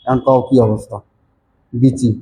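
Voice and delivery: mock the dialect, বিচি with plain b and ch; teasing a student about the local way of saying ভিসি as বিচি; Mymensingh accent. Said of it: "ভিসি কে উচ্চারণ করে বিচি".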